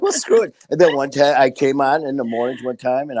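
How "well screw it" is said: shrugging it off. Retold or imitated. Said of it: "It's just like".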